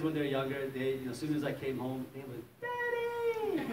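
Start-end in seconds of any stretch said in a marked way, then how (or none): 2.6-3.7 put-on high squeal of a little kid shouting Daddy; excited child calling. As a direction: call out like a thrilled toddler. Kids greeting dad at door.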